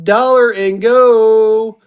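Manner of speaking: loud, very drawn-out GO; long sing-out to start bidding; auctioneer's opening call. Real, not acted. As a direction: holler it slowly, holding the last word. Auctioneer calling the opening bid.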